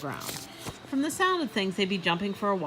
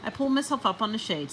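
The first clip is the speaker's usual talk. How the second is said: ordinary delivery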